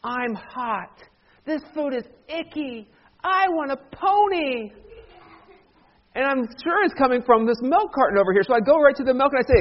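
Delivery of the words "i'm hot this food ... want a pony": higher whiny voice, last demand dragged out; drawn-out, put-on whine of a pampered kid; spoiled whining child. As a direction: play a bratty complainer rising in pitch. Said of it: "I hear this voice again".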